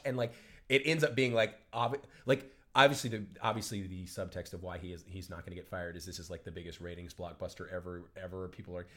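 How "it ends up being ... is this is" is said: usual speaking voice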